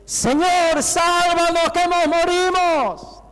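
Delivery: high, drawn-out cry for help; desperate shout. Acted. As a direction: scream it out in panic, stretching the words. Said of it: "Que gritaron".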